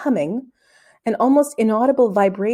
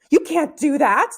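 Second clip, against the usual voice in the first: alarmed, slower protest; horrified; 6 semitones higher, 3 dB louder than usual. Acted. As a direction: stop him in horror. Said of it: "Tom stared in horror".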